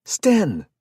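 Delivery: very loud, high shout; yelled. Acted. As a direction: yell the name across the field. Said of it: "Jared yelled".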